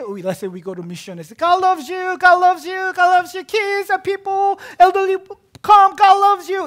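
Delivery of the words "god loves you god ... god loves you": high, loud call to a crowd. Acted. Missionary calling out to kids and elderly, loud evangelizing shout. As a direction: shout the good news to everyone passing.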